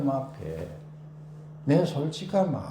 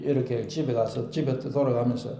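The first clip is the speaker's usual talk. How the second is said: usual speaking voice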